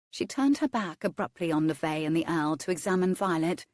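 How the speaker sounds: ordinary delivery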